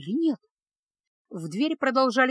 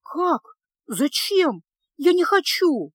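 испуганно; 6 semitones higher, 5 dB louder, slower than usual. Acted. Девушка в ужасе от новости.

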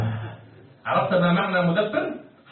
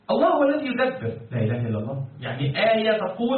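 ordinary talk

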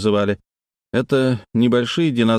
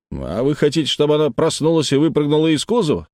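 mildly irritated retort, rising higher; snapping back; 6 semitones higher than usual. A narrator's reading. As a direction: snap back with a testy question. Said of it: "огрызнулся Хардинг". (слегка раздражённо огрызаясь)

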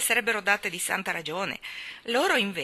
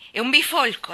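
loud, higher contemptuous insult; scornful; 7 semitones higher, 9 dB louder than usual. Acted. Colin runs down Deacon, scornful. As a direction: spit out the name-calling.

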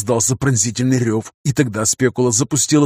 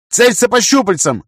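loud, high barked command; barking an order; 8 semitones higher, 4 dB louder than usual. Acted. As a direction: snap the order out hard. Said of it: "рявкнул я".

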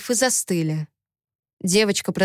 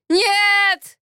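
high, drawn-out desperate yell; 13 semitones higher, 4 dB louder than usual. A narrator's reading. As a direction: cry out in anguish. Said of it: "заорал пленник". (отчаянный вопль)

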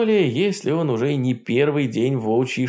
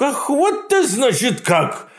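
возмущённый Кощей; 11 semitones higher than usual. Acted.

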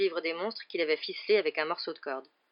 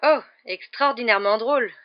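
higher, louder sarcasm; sarcastic retort; 4 semitones higher, 7 dB louder than usual. A narrator's reading. Malfoy answering Hagrid, heavily sarcastic. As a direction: throw it back in mock delight. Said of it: "répliqua Malfoy".

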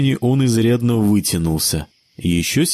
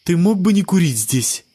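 indignant; 9 semitones higher than usual. Acted. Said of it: "возмущалась девушка".

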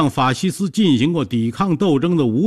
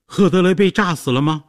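higher, urgent narrated cry; mild shouted question; 6 semitones higher than usual. A narrator's reading. Jessica rushes in after the blast, asking in a mildly raised cry. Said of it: "大叫着问".

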